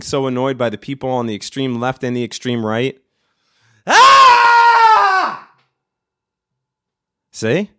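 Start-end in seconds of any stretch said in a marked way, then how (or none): 3.8-5.5 long, high scream of frustration; angry scream. Shown as a demonstration. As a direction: scream out your annoyance at full volume. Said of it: "screamy and angry right now".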